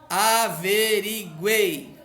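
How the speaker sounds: "Averiguei" stretched very slowly, syllable by syllable, ending on "guei"; drawn-out syllables. Shown as a demonstration. Teacher drawing out "Averiguei" so the student hears the triphthong. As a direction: spell out "Averiguei" slowly so the three final vowels show. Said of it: "Três vogais juntas lá no final".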